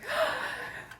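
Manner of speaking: big, high intake of breath; post-cry gasp. Retold or imitated. Showing how she feels after a cry, big gasp. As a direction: gasp in as if refreshed. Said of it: "after I cry, I'm like".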